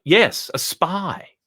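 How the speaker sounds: a high, plummy Etonian dog, pleased with itself; the dog boasts in a high, refined voice; posh dog voice. Acted. Telling the visitor about his schooling, high and plummy. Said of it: "speak like this".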